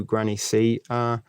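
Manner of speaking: ordinary talk, nothing marked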